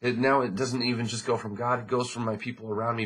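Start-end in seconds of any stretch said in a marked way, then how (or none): none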